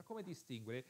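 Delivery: usual speaking voice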